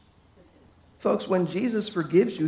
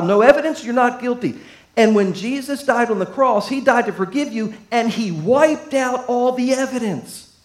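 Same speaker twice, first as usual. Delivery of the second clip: raised, louder preaching voice; 4 semitones higher, 6 dB louder than usual. Acted. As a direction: preach it hard to the congregation. Preaching builds about evidence.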